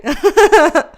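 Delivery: loud burst of laughter; big real laugh. Real, not acted. Laughs after greeting viewer.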